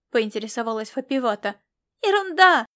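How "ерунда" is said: put-on high, loud childish brush-off; little girl shrugging it off, raised voice; dismissive young girl. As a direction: wave it off like a carefree kid. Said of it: "отмахнулась девчонка".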